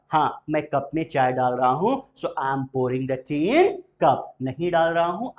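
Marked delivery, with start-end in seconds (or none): 2.1-4.2 slow, clear, word by word; slow model answer. Shown as a demonstration. Teacher models the answer for students to repeat, slow. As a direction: drill it slowly. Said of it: "बोलते चलिएगा".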